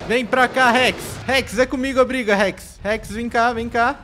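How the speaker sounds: loud, high calls to lure the beast; calling out. Real, not acted. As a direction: shout to draw the Rex's attention. Luring the Rex away.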